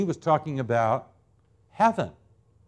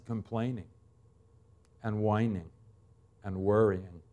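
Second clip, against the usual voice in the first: dropping softer and softer, down to almost nothing; fading to hush; 6 semitones lower, 9 dB quieter than usual. Real, not acted. Preacher to congregation, softening to a hush. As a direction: let each word fall away quieter.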